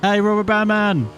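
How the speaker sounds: high, sustained playful call; high sing-out hail. Pretend. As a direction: hail a rival from across the road. Calling out to a rival rider.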